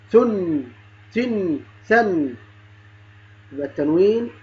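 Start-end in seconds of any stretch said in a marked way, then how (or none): 0.1-2.3 "ثن، تن، ثن" as thun, thin, than, each n held; "ثن، تن، ثن" slowly, humming each final n; tanween drill. Shown as a demonstration. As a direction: chant "ثن، تن، ثن" slowly with vowels u, i, a. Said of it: "وكذلك باقي الحروف".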